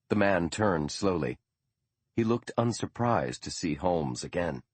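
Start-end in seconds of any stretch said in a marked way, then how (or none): none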